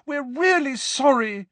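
high-pitched, pleading voice; desperate apology. A narrator's reading. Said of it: "said Hermione desperately".

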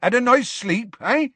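high, loud gruff-hearted keeper's call; booming, high-pitched cheery hail; hearty greeting. A narrator's reading. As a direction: call up to a sleepy giant. Giant's keeper hails waking giant.

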